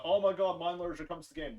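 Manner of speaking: imitating an overexcited fan; loud mock amazement; mock excited gasp. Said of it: "some people are going".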